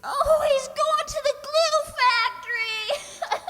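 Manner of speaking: high wail breaking into sobs; a sudden comic howl of grief; tearful wail. Acted. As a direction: burst out crying over the horse. Irma hears horse's fate.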